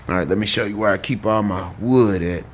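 normal talking voice

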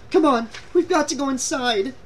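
high-pitched, alarmed plea; anxious urging. A narrator's reading. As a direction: urge him inside in a high, worried voice. Scared girl urges retreat.

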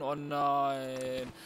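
usual speaking voice

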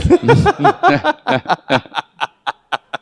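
long high-pitched laugh. Real, not acted. Guest laughing.

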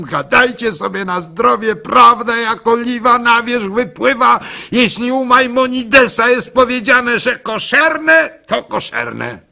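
triumphant defiance. Acted. Gimpel defiant to mocking women.

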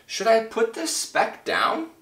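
high-pitched, worried wondering aloud; alarmed. A narrator's reading. Horton wonders whether to drop the speck, alarmed. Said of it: "Horton thought with alarm".

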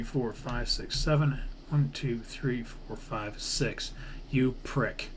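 ordinary talk, nothing marked

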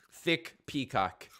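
slow, drawn-out words with a gap; slow savouring. Real, not acted. Gamer admiring a thick peacock, slow savouring. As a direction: space the two words out slowly.

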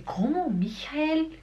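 high, questioning echo of an unfamiliar name; puzzled Brazilian. Acted. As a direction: play a baffled listener repeating it. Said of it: "Aí o brasileiro vai dizer".

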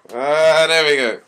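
loud reveal. Real, not acted. Fridge opens, sudden loud reveal.

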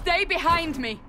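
high, loud warning yell; urgent shout. Acted. Warrior shouts to prisoner as demons attack, urgent loud yell. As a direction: bark the order over the fight. Battle erupts, protecting the prisoner.

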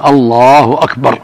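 "الله أكبر" slow, lam held, no stretch on the ba; correct takbir. Shown as a demonstration. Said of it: "الواجب أن يقول". (نطق صحيح لـ الله أكبر)

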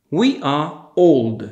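slow, carefully spaced words for a class; slow model sentence. Shown as a demonstration. Teacher to Polish students, slow clear model sentence. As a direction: say it slowly so learners can copy. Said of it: "To powtórzmy jeszcze raz".

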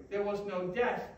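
normal talking voice

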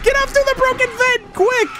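high-pitched shriek building in loudness; panicked shouting. Real, not acted. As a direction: scream in alarm while being chased. Chased by game monster.

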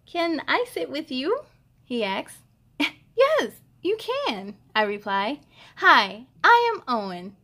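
put-on bright, lively read-aloud for kids; cheerful storybook reading; story narration. A narrator's reading. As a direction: read it like a picture book to little ones. Reads picture book aloud.

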